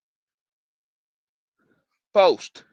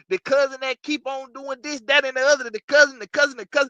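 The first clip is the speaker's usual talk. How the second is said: hyped rant; 10 semitones higher than usual. Acted. Hyped roast list of cousins.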